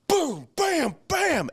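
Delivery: high-pitched impact noises; imitated blows. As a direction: punch out each blow like a comic sound effect. Acts out the baton blows.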